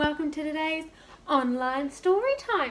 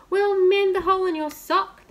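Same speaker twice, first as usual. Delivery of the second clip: higher and louder, keen helpers; eager cooks; 7 semitones higher, 8 dB louder than usual. Acted. Royal cooks eagerly promising the king, high and loud.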